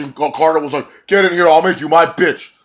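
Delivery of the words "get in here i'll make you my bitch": loud, high threat; angry shout. Retold or imitated. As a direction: yell the threat at him. Carter cussing back.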